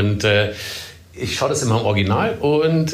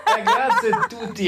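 laughing. Real, not acted. Laughing high reply.

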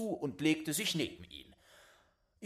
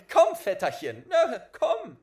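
loud, higher, laughing call; laughing cheerful call; 12 semitones higher, 12 dB louder than usual. Acted. Boy merrily calls his dead cousin over. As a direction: coax him over with a laugh.

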